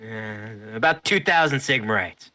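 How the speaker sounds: high, loud merchant voice; shopkeeper voice. Acted. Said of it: "he says".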